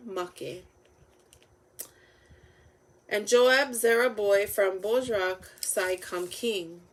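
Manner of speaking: usual speaking voice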